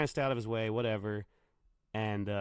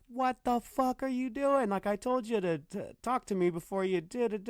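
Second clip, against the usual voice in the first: angry yell; 13 semitones higher, 4 dB louder than usual. Retold or imitated. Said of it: "And he's like".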